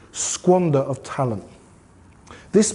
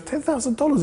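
shocked disbelief; 10 semitones higher, 4 dB quieter than usual. Retold or imitated. Lady shocked at the price.

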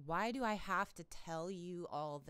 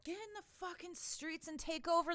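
much higher, urging call to action; rallying cry; 9 semitones higher than usual. Real, not acted. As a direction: fire up the crowd. Rallying the viewers to act.

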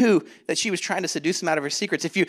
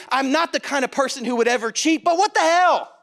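higher and louder, rising to a reckless burst; defiant self-excusing; 6 semitones higher, 5 dB louder than usual. Retold or imitated. Person rationalising cheating, defiant and loud. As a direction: justify yourself, then throw it away loudly.

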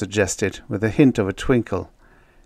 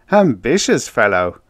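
loud, raised, mocking exclamation; giggly amusement; 4 semitones higher, 8 dB louder than usual. A narrator's reading. Joker poking fun at model builder, amused. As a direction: toss it out loudly, tickled and teasing. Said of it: "Town giggled".

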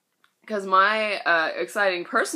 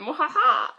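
mustache twirling villain laugh; 9 semitones higher, 5 dB louder than usual. Pretend. Gloating at getting the job.

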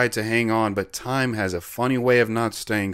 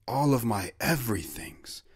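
tender whispered line; 7 dB quieter than usual. Retold or imitated. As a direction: breathe it softly to her. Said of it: "we cuddled and I said".

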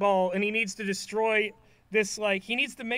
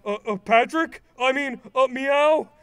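louder, fumbling Patrick in a put-on voice; flustered Patrick; 3 semitones higher, 6 dB louder than usual. Patrick disguised as a snail answering SpongeBob, flustered. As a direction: caught out, blurting then covering with meow. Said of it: "and Patrick Snail goes".

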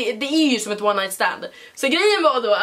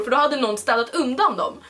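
usual speaking voice

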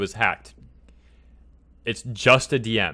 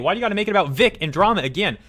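fast, raised, heated questioning; a quick, higher burst of frustration; worked-up fast rant; 7 semitones higher, faster than usual. Real, not acted. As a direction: fire it off in exasperation. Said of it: "And I'm getting worked up".

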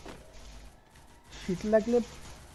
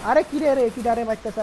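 sudden loud startled cry; surprised exclamation; 2 semitones higher, 7 dB louder than usual. Real, not acted. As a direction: blurt it out in shock. Surprised in the middle of a fight. (অবাক চিৎকার)

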